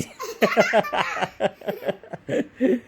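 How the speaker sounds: odd put-on giggle, high and soft; a strange little noise for fun; funny voice laugh. Real, not acted. Child making a funny laughing noise for dad's camera. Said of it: "कुठले वेगवेगळे आवाज काढते".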